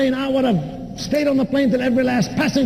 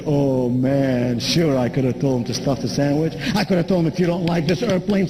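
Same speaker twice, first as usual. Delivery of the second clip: much lower, relaxed voice weighing a comeback; flight attendant musing; 9 semitones lower than usual. Retold or imitated. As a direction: mull it over low and easy. Imagined flight attendant's inner thoughts.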